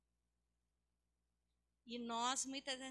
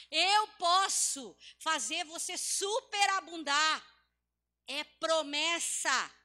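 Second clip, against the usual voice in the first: loud, drawn-out preacher's proclamation; booming sermon climax; full-force preaching; 6 semitones higher, 15 dB louder, slower than usual. Real, not acted. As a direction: preach it hard, stretching the key words.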